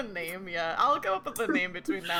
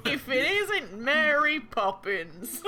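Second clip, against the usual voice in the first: slow laughing Cockney jibe; Cockney accent; 5 semitones higher, 5 dB louder, slower than usual. Pretend. GM voices bookie, laughing.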